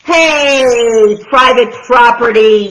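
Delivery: loud, cross warning; grumpy yell. Acted. Hank yells at the tortoise to keep out, loud. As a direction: bark it at the intruder. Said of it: "yelled Hank".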